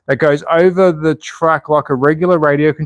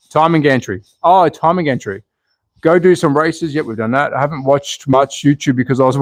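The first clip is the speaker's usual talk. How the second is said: ordinary talk, nothing marked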